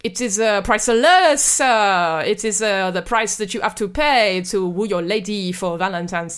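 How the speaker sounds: hammed-up French waiter accent. Waiter serving a diner arsenic cocktails, French accent. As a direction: play a fussy French waiter, lilting.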